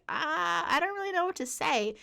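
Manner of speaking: retold awkward bewilderment. Coworkers staring, at a loss.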